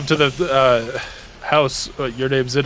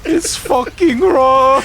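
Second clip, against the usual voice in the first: mock Ramsay yell; 10 semitones higher, 3 dB louder, much slower than usual. Gordon Ramsay parody.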